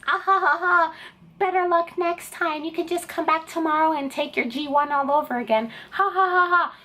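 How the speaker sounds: high put-on voice with fake ha-ha laughs; hammed-up taunting examiner, fake laughter; mocking gloat.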